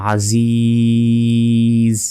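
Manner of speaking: Aziz with the vowel held long, ending on a stopped জ; held for three seconds. Shown as a demonstration. Teacher demonstrating a long stretched pause on Aziz. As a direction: draw out Aziz slowly, then stop dead. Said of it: "তিন second টেনে পড়তে হবে".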